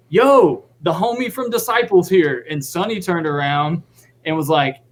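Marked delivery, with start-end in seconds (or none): 0.1-2.5 hyped announcement. Retold or imitated. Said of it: "he gets up there and goes".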